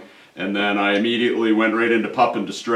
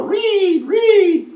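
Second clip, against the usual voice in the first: very high 'reed, reed' squeal, louder on the second; rabbit screaming; 4 dB louder than usual. Shown as a demonstration. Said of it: "you're apt to hear it, or".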